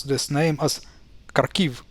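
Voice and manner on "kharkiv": Kharkiv opening on a hard K, stressed on the second syllable; kar-KIV for Kharkiv; Western K mispronunciation. Retold or imitated. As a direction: imitate Western media saying Kharkiv as kar-KEEV. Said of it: "they do not say H".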